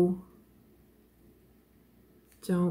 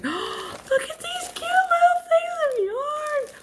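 gasp, then much higher and slow squeal; delighted gasp; slower than usual. Real, not acted. Crafter squealing over cute little balls of yarn, high and thrilled.

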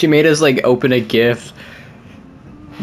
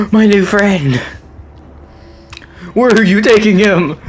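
higher, louder desperate calling out; distressed shout; 7 semitones higher, 4 dB louder than usual. Real, not acted. Player shouting at whoever takes his new friend, distressed. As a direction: plead loudly for the bear back. Bear carried off in game.

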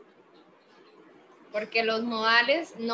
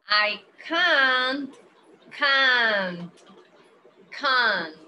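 "can't" repeated, louder; stressed can't; 4 dB louder, much slower than usual. Shown as a demonstration. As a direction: hammer "can't" for the class. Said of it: "la pronunciación es muy importante".

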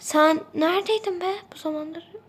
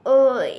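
pained groan; 8 dB louder than usual. Acted. Back pain while cleaning.

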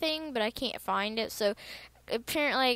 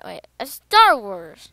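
excited shout; 3 semitones lower, 12 dB louder, slower than usual. Real, not acted. Surprised at seeing Star Wars.

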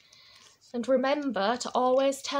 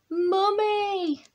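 high, drawn-out call for Mummy; storybook cry out; 7 semitones higher, 4 dB louder than usual. A narrator's reading. Child greeting Mummy at door, high cry out. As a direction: call out high, stretching the word. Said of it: "I cried".

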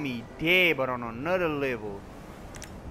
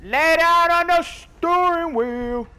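slow, high, loud taunt; stretched high yell; 13 semitones higher, 9 dB louder, much slower than usual. Real, not acted. Taunting the enemy slumped in his car, slow high yell. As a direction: drag it out high and loud at him.

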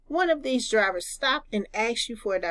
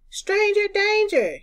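put-on high, loud child's alarm call; shouted warning; 10 semitones higher, 4 dB louder than usual. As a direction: sing out the warning like a kid.

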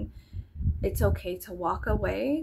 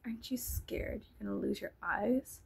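mock snide whisper; 8 dB quieter than usual. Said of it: "And she was like".

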